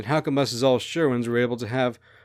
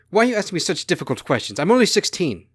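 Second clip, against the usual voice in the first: higher, louder, exasperated protest; frustrated, angry; 6 semitones higher, 6 dB louder than usual. A narrator's reading. Teenage Titania protests Apollyon's difficult questions, frustrated and angry. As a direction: snap back in angry frustration. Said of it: "gets frustrated and angrily asks".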